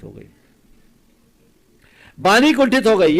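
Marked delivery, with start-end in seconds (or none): 2.2-3.2 very loud, high-pitched repeat; emphatic proclamation. Acted. Preacher driving the moral into listeners, loud and emphatic. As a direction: hammer the point home at full voice. Repeats the outcome at full force.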